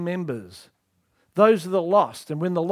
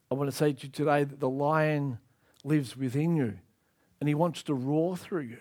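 usual speaking voice

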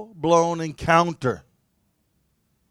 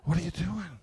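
soft and gentle, the second line whispered; compassionate voice; 14 dB quieter than usual. Retold or imitated. God tenderly interrupts the despairing man, soft then whispered. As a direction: ask kindly, then breathe the promise. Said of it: "it was so compassionate".

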